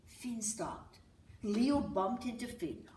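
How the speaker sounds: plain reading voice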